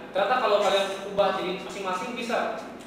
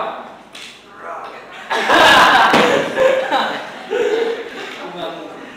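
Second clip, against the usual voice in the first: long open burst of laughter; hearty real laugh; 4 semitones higher, 7 dB louder than usual. Real, not acted. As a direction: laugh out loud at a joke. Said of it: "Itu di, kalau orang itu di Bekasi ya".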